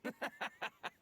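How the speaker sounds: short breathy scornful laugh; gloating laugh. Acted. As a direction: laugh at their silence. Thug gloats at silence.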